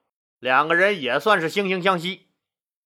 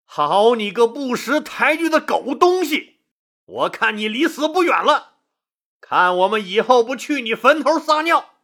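raised-pitch, acted outrage; indignant anger; 5 semitones higher than usual. A narrator's reading. Insulted generals cursing Han Xin, indignant anger. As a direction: curse him out in offended fury, pitched up. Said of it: "他们大为恼怒了".

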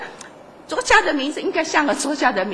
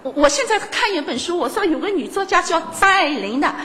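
normal talking voice

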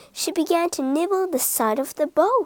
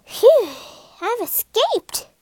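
higher, slow relieved sigh; relieved phew; 10 semitones higher, slower than usual. A narrator's reading. Rat out of the tunnel, relieved. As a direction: savour the escape.

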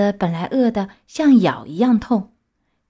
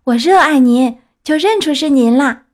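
put-on high, loud, adoring puppet boy; eager Pinocchio; 5 semitones higher, 6 dB louder than usual. Puppet tells his fairy he loves her, eager. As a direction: declare it in an eager child voice.

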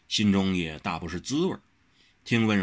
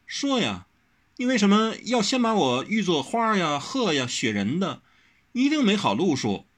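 urging; 11 semitones higher than usual. A narrator's reading. Wen Rou pestering Wang Xiaoshi to talk. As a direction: push him to spit it out. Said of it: "催促地说".

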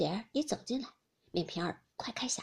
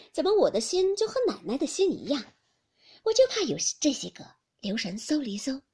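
louder, lifted voice feigning innocence; unruffled maid Ping'er; 4 semitones higher, 6 dB louder than usual. Acted. Maid covering for the master before her mistress, feigned innocence. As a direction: play the maid covering up cheerfully.

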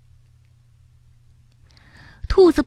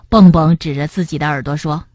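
usual speaking voice

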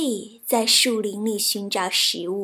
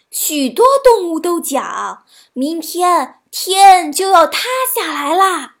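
high, loud fox spreading dire news; feigned alarm; 6 semitones higher, 6 dB louder than usual. A narrator's reading. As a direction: make the news sound shocking. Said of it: "狐狸装作吃惊的模样说". (装作吃惊地高声报信)

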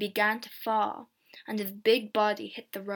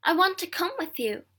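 calling out; 7 semitones higher than usual. A narrator's reading. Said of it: "I called to my sister".

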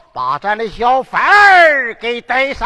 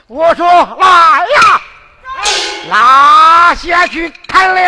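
提高嗓门喝令推出斩首; 威严地大声传令; 怒喝下令; 4 semitones higher, 4 dB louder than usual. Acted. 父王再次下令斩人. (父王怒喝传令斩薛满儿)

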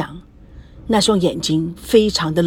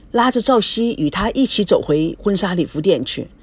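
ordinary delivery, nothing marked

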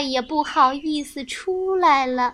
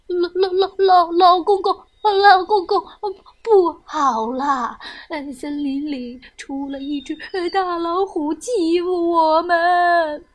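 scared stammering; 2 semitones higher than usual. Acted. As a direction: panicky little animal stuttering for help. Frightened animals plead for help.

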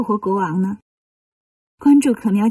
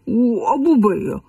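slow, drawn-out whine; whining refusal; slower than usual. Acted. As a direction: refuse like a sulky character, dragging it out. Bajie refuses to carry the body.

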